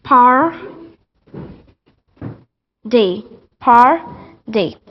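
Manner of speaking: PAR, a pause, then D; T turned to D. Shown as a demonstration. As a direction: break party into a rolled "par" and a "D". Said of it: "it will turn to D".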